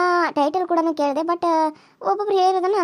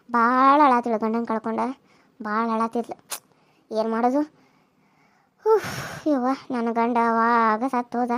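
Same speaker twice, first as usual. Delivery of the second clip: lower, subdued elder's voice; sorrowful mother-in-law; 3 semitones lower, slower than usual. Acted. Mother-in-law back from the bereaved house, sorrowful. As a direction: sigh over a relative's loss in a low voice.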